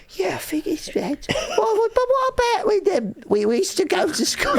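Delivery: very high, squawky fake Cockney; painfully bad falsetto Cockney; shit Cockney accent. Comedian replaying himself at a read-through, awful Cockney. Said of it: "with this shit accent".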